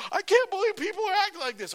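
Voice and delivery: gasp, then very high, loud disbelief; hammed-up horrified squeal; mock shock. Preacher mimicking shock at people during covid, exaggerated disbelief.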